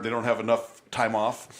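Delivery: ordinary talk, nothing marked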